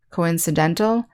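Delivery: "coincidental" stressed on DEN, tune rising; co-in-ci-DEN-tal, rising as in a list; second-to-last-syllable stress. Shown as a demonstration. Teacher recapping the word forms, stressing DEN in "coincidental". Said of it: "stress on the second to last syllable".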